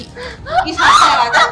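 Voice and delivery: high, loud furious yelling; enraged shout. Acted. Customer orders the offending hand broken, high furious shouting. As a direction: shriek the threat in rage.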